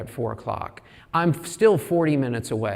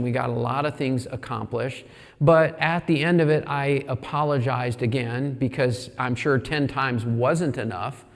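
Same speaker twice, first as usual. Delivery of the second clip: ordinary talk, nothing marked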